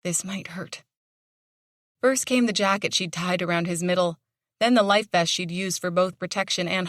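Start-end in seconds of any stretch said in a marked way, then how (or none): 0.0-0.8 softer, hushed warning; quietly brace the dog; hushed warning to a hurt dog; murmured. A narrator's reading. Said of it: "she murmured".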